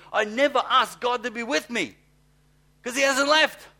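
shouting it high and loud; preacher getting mad, voice rising to a shout; fired up. Real, not acted. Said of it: "I get so mad".

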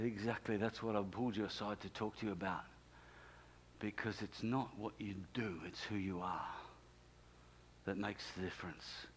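low, soft voice of God; quiet explaining. Retold or imitated. God gently answers the pastor.

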